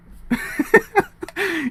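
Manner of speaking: high, squealing laugh; real uncontrolled giggles; helpless laughter. Real, not acted. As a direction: lose it laughing. Cracking up after the rant.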